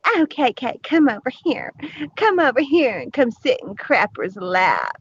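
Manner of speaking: high, sing-song mock of a fawning teen. Mocking a rival online, in a high fawning coo. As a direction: coo it like a smitten schoolkid, mocking. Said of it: "the new boy in town".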